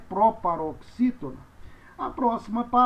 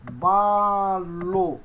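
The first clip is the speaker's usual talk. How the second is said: "balo" in two long syllables; slow, syllable-by-syllable model; pronunciation demo; 4 dB louder than usual. Shown as a demonstration. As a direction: stretch "balo" slowly for learners. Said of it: "Essa é a pronúncia".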